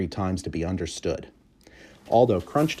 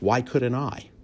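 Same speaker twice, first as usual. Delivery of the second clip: usual speaking voice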